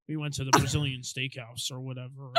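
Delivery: low put-on dude voice; guy voice. Young guy excusing his absence to the pastor, low dude voice. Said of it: "The guys are like".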